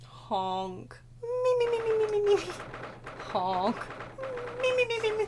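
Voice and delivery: high squeaky me me with honks; goose noises. Acted. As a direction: honk and bleat like a silly goose.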